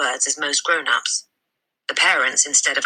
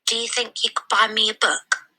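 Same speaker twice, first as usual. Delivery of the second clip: put-on high, small and polite child's voice; little girl voice; 6 semitones higher than usual. Matilda asks her father for a book, in a high little-girl voice. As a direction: ask sweetly like a small girl. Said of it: "she said".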